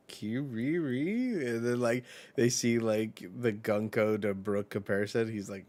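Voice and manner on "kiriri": slow, high questioning chirp; a little critter asking something; stretched, curious chirp; creature chirp. Retold or imitated. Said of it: "then Ratatouille is like".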